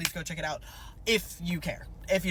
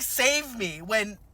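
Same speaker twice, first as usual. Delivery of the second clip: normal talking voice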